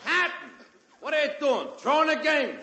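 high mocking Brooklyn heckle; heckling fan. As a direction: jeer at the pitcher. Heckler yelling at pitcher.

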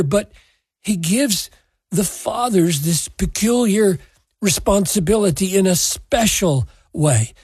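ordinary talk

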